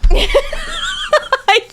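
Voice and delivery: high-pitched cracking-up laugh; real laughter. Real, not acted. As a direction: burst out laughing helplessly. Cracking up at the bit.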